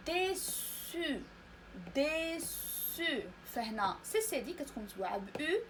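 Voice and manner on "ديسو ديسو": "ديسو" very slow, two held syllables with a gap; model pronunciation. Shown as a demonstration. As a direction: draw out dé, then su with a clear s in "ديسو". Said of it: "هنا كنسمعو س". (نطق "ديسو" مقطّعًا ببطء شديد)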